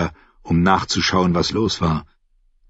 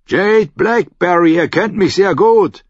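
higher and louder, hands cupped round the mouth; calling up; 10 semitones higher, 5 dB louder than usual. Acted. Davos calls up to the guard on the wall. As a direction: call up to the gate.

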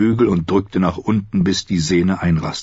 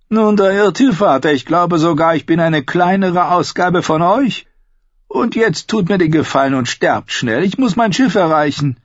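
higher voiced bitter son, probing his father; a higher, defiant son testing his father; Tyrion's voice; 11 semitones higher, 3 dB louder than usual. Son asking his father about punishment, higher defiant voice.

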